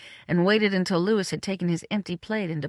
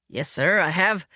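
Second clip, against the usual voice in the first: firm, hearty yes-sir. A narrator's reading. Man assures his powerful employer, firm and hearty. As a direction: answer up firmly and heartily. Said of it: "keeping my voice firm and hearty".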